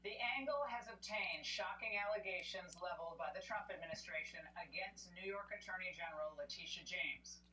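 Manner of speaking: lightly teasing news read; tabloid tv host. Acted. News host reading story.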